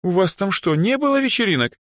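high, disbelieving question; high-pitched girlish incredulity; incredulous. A narrator's reading. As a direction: ask in baffled disbelief, climbing in pitch. Disbelief at no parties.

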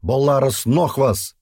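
high, slow, loud cry; sudden call. A narrator's reading. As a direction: call it out slowly into the silence. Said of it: "вдруг прозвучал в предбоевой тишине". (внезапный высокий протяжный возглас)

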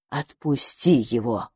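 low, slow grave command; eldest house spirit. A narrator's reading. Eldest house spirit intervenes, low firm order. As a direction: intervene slowly and low as the elder. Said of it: "вмешался самый старший домовой Мелкослав".

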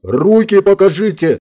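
high, drawn-out yelled order; acted agitated shout; yelling. A narrator's reading. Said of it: "снова заорал Влад".

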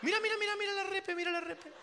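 very high monotone chatter; pitched-way-up mock excited voice; high squeaky aside. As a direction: repeat it quickly in a squeaky falsetto.

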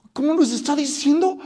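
can't believe the insult; indignant disbelief. Acted. David reacting in disbelief to Goliath's insults. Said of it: "yo creo que David dice".

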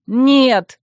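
loud, high cry of protest; exclaiming. A narrator's reading. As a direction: burst out with a refusal. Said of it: "воскликнули мы втроём".

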